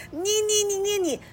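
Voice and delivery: high, stammering cry of frustration; exasperated yell. A narrator's reading. The tailor, fed up with Li Guangtou, sputtering in an exasperated yell. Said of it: "恨铁不成钢的喊叫".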